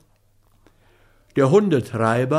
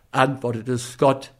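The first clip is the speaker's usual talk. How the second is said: plain reading voice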